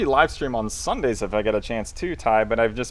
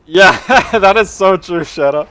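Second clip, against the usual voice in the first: laughing; 7 semitones higher, 8 dB louder than usual. Real, not acted.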